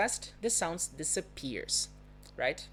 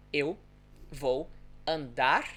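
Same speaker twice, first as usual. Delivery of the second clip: slow pronunciation; slower than usual. Shown as a demonstration. Teacher showing learners the vibrating sound, slow pronunciation. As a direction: spell out "Eu vou andar" slowly, pausing between words. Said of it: "if I pronounce it slowly".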